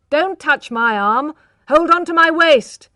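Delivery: loud, high shout; loud shout at a frightened girl. A narrator's reading. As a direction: bark orders over the rushing carriage. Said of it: "he shouted".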